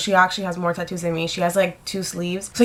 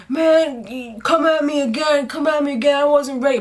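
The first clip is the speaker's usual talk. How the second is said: higher voice mimicking the beaten man; hammed-up, pitched-up protest; mock challenge; 6 semitones higher than usual. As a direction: imitate a sore loser calling out. Beaten man's retold taunt from train.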